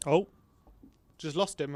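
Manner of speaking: normal talking voice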